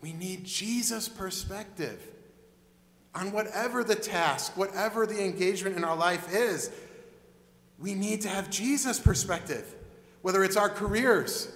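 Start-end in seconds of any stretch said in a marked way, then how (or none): none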